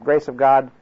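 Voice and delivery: ordinary delivery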